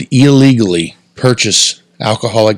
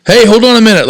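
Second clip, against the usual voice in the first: calling out; 11 semitones higher, 4 dB louder, faster than usual. Retold or imitated. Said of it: "the guy come running out the door like".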